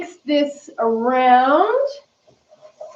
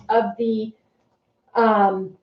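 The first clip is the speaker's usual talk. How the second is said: ordinary talk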